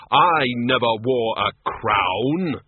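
shaking with anger. Acted. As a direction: spit out each word in rage. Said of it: "His voice shook with rage".

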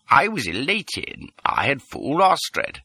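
usual speaking voice

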